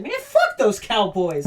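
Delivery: hammed-up indignation, dragging out the words; mock fan outrage. Raiders fan cursing the rival Cowboys, in mock outrage.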